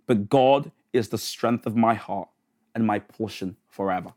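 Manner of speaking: low, measured psalm reading with pauses; scripture reading. Acted. Reads psalm verses aloud.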